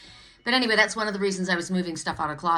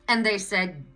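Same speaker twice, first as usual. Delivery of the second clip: normal talking voice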